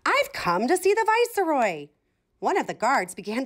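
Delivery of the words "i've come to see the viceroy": much higher, self-important little chicken; proud chick. A narrator's reading. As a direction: announce yourself grandly, high. Chicken speaks to guards.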